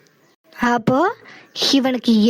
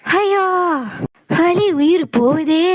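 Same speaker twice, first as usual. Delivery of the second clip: mock cry of pain; slower than usual.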